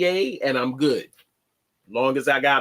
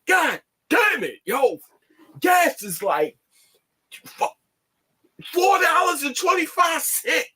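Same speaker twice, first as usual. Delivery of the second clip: imitating pissed-off guys. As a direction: mimic the brothers yelling about gas prices. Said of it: "They was pissed".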